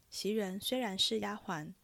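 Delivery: ordinary delivery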